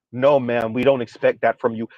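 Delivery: low flat put-down; deadpan dismissive. Retold or imitated. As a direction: dismiss her dryly and deep. Mock reply to the woman.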